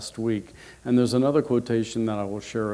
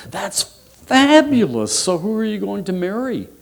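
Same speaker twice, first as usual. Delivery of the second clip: gushing excitement; 8 semitones higher, 5 dB louder than usual. Acted. Potato mother to her engaged daughter, gushing excitedly. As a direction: gush like a thrilled mother. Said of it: "squealed with enthusiasm".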